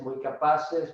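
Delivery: normal talking voice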